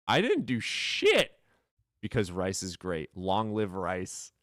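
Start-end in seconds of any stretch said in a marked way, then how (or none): 0.1-1.3 high, loud, drawn-out denial; indignant outburst, stretched and loud; loud protest. Real, not acted. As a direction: protest your innocence at a shout. Protesting a playful accusation.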